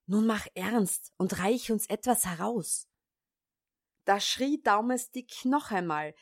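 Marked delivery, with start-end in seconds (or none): none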